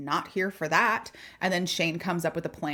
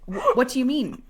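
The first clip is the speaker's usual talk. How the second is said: confused dad; 6 semitones higher, 4 dB louder than usual. Retold or imitated. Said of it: "David also oblivious king".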